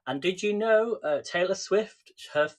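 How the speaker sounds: ordinary delivery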